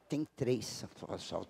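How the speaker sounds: ordinary delivery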